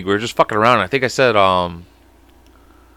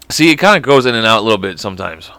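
ordinary delivery

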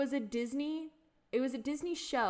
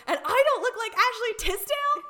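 much higher, louder indignant squeal; Maddie's outraged quote; 12 semitones higher, 10 dB louder than usual. Retold or imitated. Quoting Maddie to her friend, high indignant squeal. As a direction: squeal it like an offended teen. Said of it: "And she goes".

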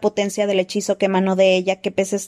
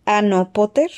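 a cold, slightly higher, chilling voice; slower than usual. A narrator's reading. Voldemort appears and coldly taunts Potter in a high voice. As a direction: speak icily, like a serpent-faced dark lord. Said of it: "dijo una voz fría y aguda".

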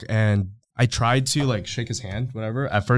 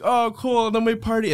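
imitated group cheer, pitched way up; mock excitement; 12 semitones higher than usual. Men offered vodka all agree, high mock excitement. Retold group accepting a drink.

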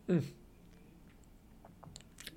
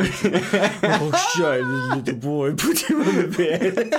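higher, louder, slow cooing through laughter; doting baby talk; 6 semitones higher, 6 dB louder, much slower than usual. Retold or imitated. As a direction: coo over the big dog like a little boy. Doting owners letting dog on bed.

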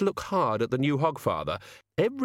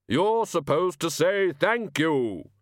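much higher, louder, slow pronouncement; grand Hogfather; 7 semitones higher, 4 dB louder, much slower than usual. Acted. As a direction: boom it out like a solemn decree. Hogfather repeats the prompt grandly.

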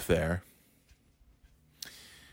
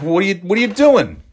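exasperated; 9 semitones higher than usual. Retold or imitated. Exasperated at braking driver on on-ramp.

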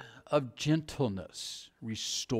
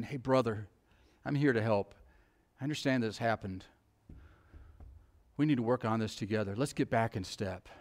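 softer, gentle brotherly appeal; prayerful, gentle; 5 semitones lower, 6 dB quieter than usual. Retold or imitated. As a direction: speak it prayerfully, in a right tone. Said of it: "prayerfully saying".

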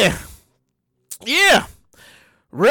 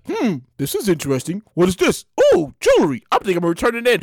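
much lower, dry voice; mock square finder; 8 semitones lower, faster than usual. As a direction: play the boring do-gooder. Finder mocked as dull.

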